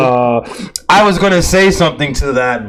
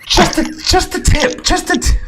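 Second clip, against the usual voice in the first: mimicking a remembered line. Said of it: "I'm still hearing it in my head".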